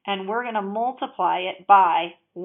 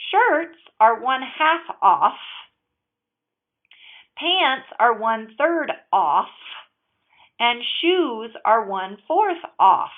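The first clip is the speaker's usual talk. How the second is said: slow and loud, leaning hard on each "off"; stressing off; 3 semitones higher, 4 dB louder, slower than usual. Shown as a demonstration. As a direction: drag out every "off" so the kids notice it. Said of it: "why are you saying off like that?".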